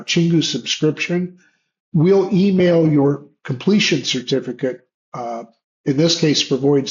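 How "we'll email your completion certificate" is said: normal talking voice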